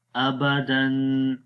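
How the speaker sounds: ابدن said very slowly as abadan; joined reading. Shown as a demonstration. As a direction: blend ابدن smoothly, no stretch. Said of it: "اب ان تینوں کو ملا کر پڑھیں گے". (ملا کر آہستہ پڑھنا)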